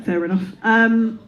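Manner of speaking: ordinary talk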